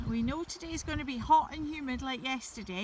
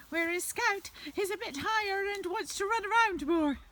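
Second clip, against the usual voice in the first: higher, lively voice; a bit higher; 8 semitones higher, 3 dB louder than usual. Real, not acted. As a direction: lift the pitch for the livelier dog. Describes livelier dog in higher voice.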